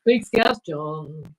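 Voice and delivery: drawn out, savouring each word; slow relish. Pretend. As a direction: stretch it out like a grand title. Savouring the name.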